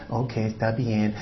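low, soft, slow concession; giving-in parent. Acted. Preacher as parents giving in to pleading children, low resigned reply. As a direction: drop it down and give in quietly. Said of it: "Y los papás".

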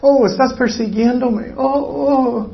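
high mock-wounded whine; put-on victim's wail; playing the victim. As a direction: moan as if persecuted, hammed-up. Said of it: "actúan como la víctima".

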